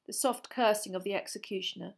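ordinary delivery

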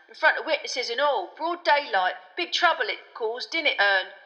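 loud, chummy know-it-all conductor; Stan the chatty conductor. A narrator's reading. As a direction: play a cocky bus conductor chatting loudly.

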